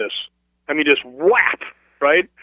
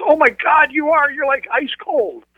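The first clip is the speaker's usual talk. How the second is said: retold shocked exclamation, pitched much higher, slowing at the end; shocked gasp; 12 semitones higher, slower than usual. Girl at the table touching his icy arm, startled high exclamation.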